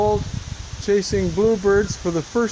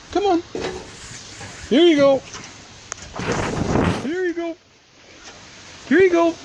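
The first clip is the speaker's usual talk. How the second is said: very high sing-song coaxing; coaxing a wild bird; 11 semitones higher than usual. Real, not acted. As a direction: coax it out in a high voice.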